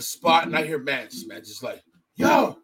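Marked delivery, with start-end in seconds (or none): 2.2-2.6 mock angry outburst. Retelling Mance popping off.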